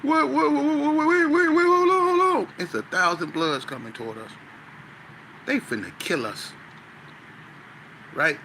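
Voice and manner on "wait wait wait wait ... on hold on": high, loud frantic pleading; panicked pleading. Retold or imitated. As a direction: beg them to stop in sheer panic. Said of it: "rocking back and forth going".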